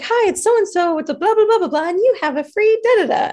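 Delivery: high, sing-song mock sales caller; mock telemarketer. Mocking the woman who phoned her with a ticket offer, high chirpy sales voice. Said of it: "she's like".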